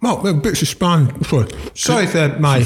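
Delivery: talking while eating; words round a bite of waffle; mouth full. Real, not acted. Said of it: "Don't talk with your mouth full".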